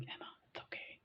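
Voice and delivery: hushed whisper, urging her to stop; Sylvie whispering. Retold or imitated. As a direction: whisper it like a worried friend. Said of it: "Sylvie would be like".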